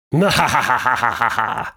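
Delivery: long rapid cackle, ha-ha-ha; hammed-up villainous laughter; mock evil laugh. Storyteller relishing the dog's dark end, mock evil cackle.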